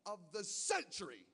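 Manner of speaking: slow, overblown announcer voice; hammed-up CNN headline; dramatic news anchor. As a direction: stretch it out like breaking news. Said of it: "this is what they called the storm".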